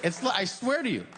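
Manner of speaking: ordinary talk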